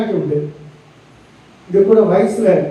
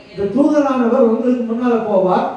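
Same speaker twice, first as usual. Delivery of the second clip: ordinary talk, nothing marked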